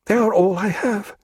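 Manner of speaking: high, muttered woman's voice. A narrator's reading. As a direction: mutter it, clinging to them. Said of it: "she muttered after a while".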